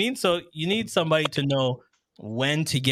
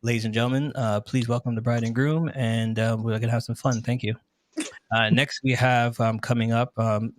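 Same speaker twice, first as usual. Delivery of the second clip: imitating a dull, monotone MC; low, even announcement with no excitement; mock a lifeless wedding announcer; straight monotone; 4 semitones lower than usual. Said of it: "they're just straight monotone for the whole thing".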